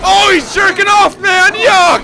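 loud grossed-out yelling; disgusted shout. Real, not acted. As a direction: yell in disgust, high.

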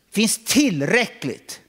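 finns drawn out high and loud; stress on finns. Real, not acted. Preacher stressing to the room that enough is present, slow. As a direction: lean hard on finns.

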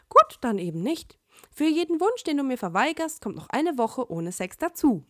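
usual speaking voice

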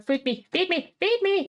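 put-on insistent high-pitched baby demand; mock infant pleading. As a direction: beg with mounting playful urgency. Hungry infant begs to be fed.

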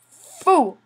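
loud, scornful fool; mock taunt. Gloating at the zombie he beat, loud mock taunt. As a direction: sneer it like a villain.

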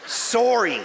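normal talking voice

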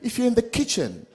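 soft, low, slow love line; mock romantic text. Man reading his romantic text to his girlfriend, softly. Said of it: "I told her".

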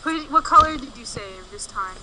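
normal talking voice

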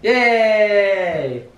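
high, long-held celebratory cry; happy cheer. Real, not acted. Parent cheering on baby, high and happy. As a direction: cheer for a little one, stretched out.

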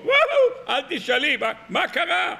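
high-pitched panicked wailing, hammed up; mock screaming. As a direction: wail like a boy faking disaster. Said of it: "צועק צעקות נוראות".

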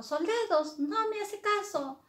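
high, indignant call to soldiers; angry queen. Acted. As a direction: complain imperiously in a high queen voice. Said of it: "la reina le dijo".

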